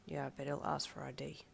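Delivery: soft, low-key grumble; grunted. A narrator's reading. Darby expecting an ID check, grunting. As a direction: grumble it quietly. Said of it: "Darby grunted".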